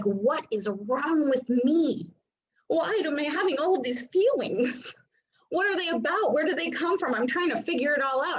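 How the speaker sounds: high-pitched, mock-exasperated string of questions; overwhelmed questioning. Open emotional center asking why all the feelings, mock exasperation. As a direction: act out being baffled by your own feelings.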